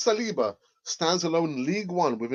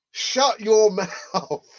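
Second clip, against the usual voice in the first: laughing mock scold; 5 semitones higher, 6 dB louder, much slower than usual. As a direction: shut your mouth said slowly through laughter.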